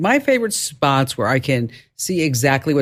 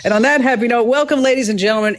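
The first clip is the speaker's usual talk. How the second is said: announcer opening; 8 semitones higher, 3 dB louder, faster than usual. Acted. Show opening announcer read.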